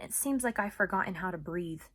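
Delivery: plain reading voice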